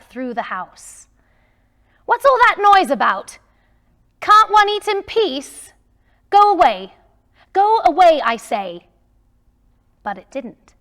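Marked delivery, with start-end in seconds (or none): 2.1-8.9 loud, much higher irritated yell; angry Scrooge. Acted. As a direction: yell at the noises to leave him be. Scrooge yells at the noises.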